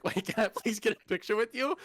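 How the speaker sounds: gushing like a thrilled fan; excited fan. Retold or imitated. Retells excited fan request to actor.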